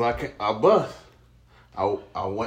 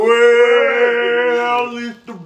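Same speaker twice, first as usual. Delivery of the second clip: Big Show impression; 6 semitones higher, 7 dB louder, much slower than usual. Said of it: "He be like".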